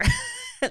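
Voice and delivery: high laugh bursting out; burst of laughter. Real, not acted. Laughing at the boys' punishment, high burst. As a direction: let a laugh break out high.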